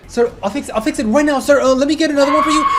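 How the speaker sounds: high, fast, frantic pleading to an angry customer; panicked shop girl. Retold or imitated. Cashier begging a furious customer, high frantic pleading. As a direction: babble apologies and offers as fast as you can. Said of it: "The poor girl working there like".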